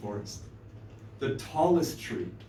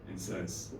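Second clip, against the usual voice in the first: usual speaking voice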